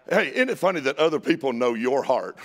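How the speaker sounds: usual speaking voice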